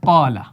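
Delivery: क़ाला with a deep throaty क़ and a long aa; Quran recitation demo. Shown as a demonstration. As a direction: Quranic tajweed. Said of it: "हम कुरान में अक्सर पढ़ते हैं".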